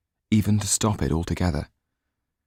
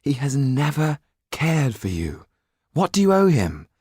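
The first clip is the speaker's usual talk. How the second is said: pleading, rising higher and louder on the question; desperate plea, pitched up on owe; 9 semitones higher, 3 dB louder than usual. A narrator's reading. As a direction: push hard, desperate to make her see. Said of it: "He said in desperation".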